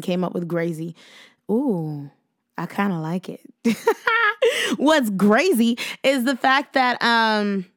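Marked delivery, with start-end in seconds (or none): 3.6-4.7 bright burst of laughter. Real, not acted. Laughs at own made-up word.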